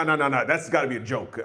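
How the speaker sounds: ordinary talk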